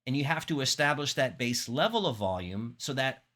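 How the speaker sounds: usual speaking voice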